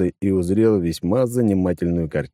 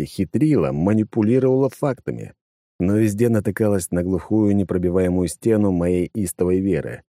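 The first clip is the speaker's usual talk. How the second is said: ordinary delivery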